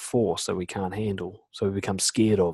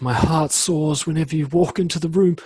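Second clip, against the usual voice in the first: mock-poetic gushing, pitched up; overblown romantic declaration; 6 semitones higher, 4 dB louder than usual. Shown as a demonstration. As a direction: gush it like a hammed-up love poem. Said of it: "you don't have to talk like that".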